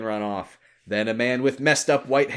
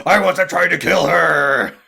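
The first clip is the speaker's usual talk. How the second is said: loud protest; 7 semitones higher, 11 dB louder than usual. Acted. Character protests loudly.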